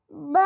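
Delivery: ब as a short "buh", not "bee"; phonics letter sound. Shown as a demonstration. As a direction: say ब as the bare B sound. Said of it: "क्या पढ़ेंगे?". (ब की छोटी ध्वनि)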